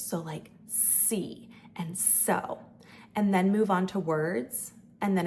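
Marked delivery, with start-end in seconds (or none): 0.7-2.6 C and so drawn out, stretching the s; slow s syllables. Shown as a demonstration. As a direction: stretch the s in so slowly. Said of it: "simple imitation".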